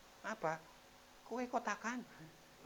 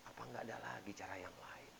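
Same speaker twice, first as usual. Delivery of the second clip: a hushed, grumbling question to oneself; mock whisper of someone who doubts God; whispered complaint; 7 semitones lower, 11 dB quieter than usual.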